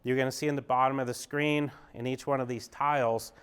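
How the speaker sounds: ordinary talk, nothing marked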